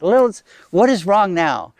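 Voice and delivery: mock exasperation; exasperated asking. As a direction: ask as if tired of the complaints. Goddess weary of Lilith's upset.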